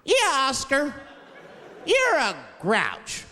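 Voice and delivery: put-on high, slow sing-song kids' taunt; taunting kids. Sesame Street kids teasing Oscar, high and slow sing-song. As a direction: drag it out like teasing children.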